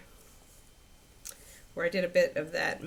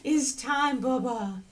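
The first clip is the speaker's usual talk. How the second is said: voicing the dog; 4 semitones higher, 4 dB louder, much slower than usual. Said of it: "Kiki says".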